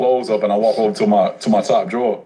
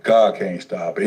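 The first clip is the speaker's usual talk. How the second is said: ordinary talk, nothing marked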